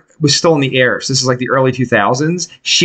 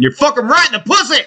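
high, fast crude shout; prank caller shout; 11 semitones higher, faster than usual. Retold or imitated. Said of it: "that guy who's like".

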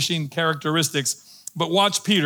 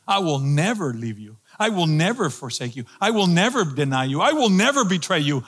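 fervent vow; 3 semitones higher than usual. Retold or imitated. Peter swearing loyalty to Jesus, increasingly fervent. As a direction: pile up each promise with rising fervour. Peter's vows, acted, building.

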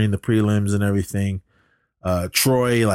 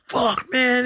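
much higher, strained mock outburst; imitating a furious skater, pitched way up; mock angry yell. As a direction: squeal it like a sore loser. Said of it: "he's yelling about Derek".

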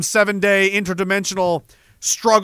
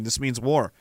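normal talking voice

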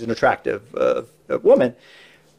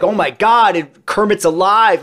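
raised, louder awestruck exclaim; amazed gasp; 7 semitones higher, 4 dB louder than usual. Retold or imitated. Crew member marveling at puppet coming alive, awestruck and louder. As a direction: gasp like Kermit just came alive. Retelling crew's amazement on set.

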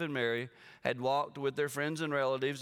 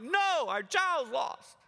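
panicked; 10 semitones higher, much slower than usual. Retold or imitated. Father answering his wife, panicked high plea. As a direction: insist in a panic that the child is lost. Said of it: "I'm like".

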